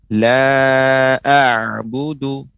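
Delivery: "la a'budu" slow and drawn out, with a throaty ain; pengucapan ain. Shown as a demonstration. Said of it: "Ain ini pengucapannya adalah memantul". ("la a'budu" dipanjangkan dengan ain yang jelas)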